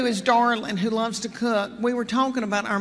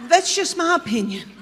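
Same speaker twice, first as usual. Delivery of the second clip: prim little higher voice repeating her line; imitating Gail, higher; 7 semitones higher than usual. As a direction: play the sweet mother-in-law insisting on her opinion. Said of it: "She goes".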